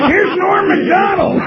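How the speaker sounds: high, slow stage announcement; announcer shout. Retold or imitated. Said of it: "I got, like".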